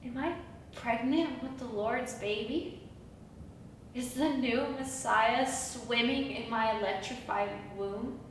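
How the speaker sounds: soft, low, tentative questions; hushed wonder. Acted. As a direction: ask it softly, half daring to believe. Wonders if carrying God's baby.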